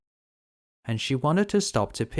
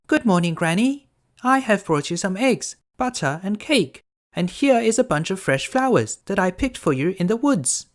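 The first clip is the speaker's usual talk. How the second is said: put-on much higher, bright-eyed little girl; 10 semitones higher, 5 dB louder than usual. Girl answering the wolf, high and innocent.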